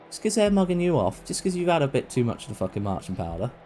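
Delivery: usual speaking voice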